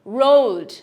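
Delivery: রোলড loud with a firm D; strong final D. Shown as a demonstration. Teacher models past tense, রোলড with strong D. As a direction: land hard on the D of "রোলড". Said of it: "strong একটা ডি উচ্চারণ".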